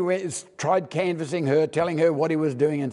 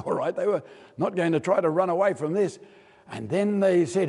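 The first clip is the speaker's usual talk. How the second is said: normal talking voice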